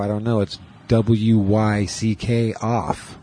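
usual speaking voice

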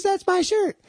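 very high mock woman claiming the shirt; mock shrill woman. Woman snatching the shirt back, very high mock voice. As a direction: squeak it as the grabbing woman.

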